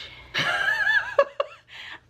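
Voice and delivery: squealing giggle; high embarrassed laugh. Real, not acted. Recalling the sex scene to viewers, high flustered laugh.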